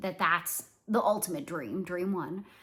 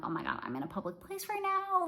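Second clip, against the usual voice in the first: higher-pitched mock panic at usual volume; retold mild panic; 10 semitones higher than usual. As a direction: replay a rising "oh no" thought, pitched up. Retold panicky thought in public.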